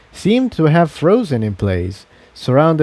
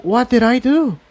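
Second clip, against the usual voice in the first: much higher, anxious; worried Incendia; 11 semitones higher than usual. Acted. Incendia asks what she has done, high and anxious. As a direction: fret over what she caused.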